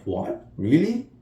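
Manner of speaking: shocked disbelief. Retold or imitated. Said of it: "Agnes is like".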